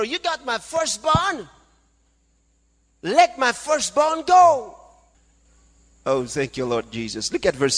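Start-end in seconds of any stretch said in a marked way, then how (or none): 0.0-4.8 high, rising preacher's cry, drawn out; thundering command. Acted. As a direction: build to a loud demand to Pharaoh. God's command to Pharaoh, acted.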